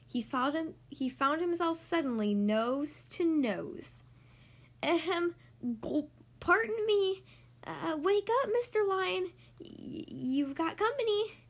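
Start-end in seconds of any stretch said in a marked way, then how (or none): 4.8-11.4 high, hesitant tiny voice; a timid mouse clearing his throat; nervous little mouse. Acted. Mouse nervously wakes the sleeping lion, high and timid.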